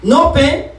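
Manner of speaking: ordinary talk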